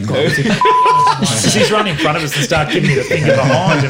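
high, loud laughing; burst of laughter. Real, not acted. Hosts crack up at the stranger giving the finger. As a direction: crack up mid-story. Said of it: "Edit it out".

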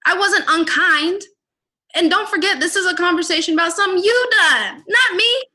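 loud, high, exasperated pushback; loud, high, fed-up rant; heated retort. Retold or imitated. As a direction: push back hard and refuse to back down. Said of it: "and I'm like".

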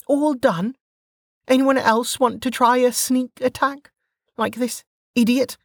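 put-on high-pitched brash young woman; Velika's voice. A narrator's reading. Velika scolding the downed sentinel, high and cocky. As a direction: toss it off, cocky and unbothered.

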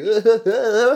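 high, loud burst of laughter. Real, not acted. Laughing about bandmates.

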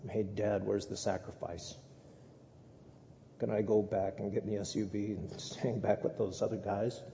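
put-on low, soft, nervous boy asking his father; a quietly worried son, not composed; anxious Isaac. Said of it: "I'm not sure Isaac was really that composed".

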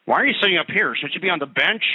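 imagined bigot. Retold or imitated.

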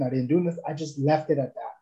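ordinary talk, nothing marked

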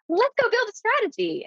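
high bubbly exclamation-point voice; mocking a peppy over-eager email; over-excited mock cheer. Boss mocking an exclamation-heavy email, high and bubbly. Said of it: "I don't wanna be like".

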